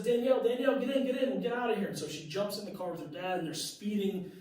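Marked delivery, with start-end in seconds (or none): 0.0-1.9 high, louder urgent call. Retold or imitated. Dad in the dream urging his daughter, calling out. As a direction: hurry her into the car. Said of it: "It says".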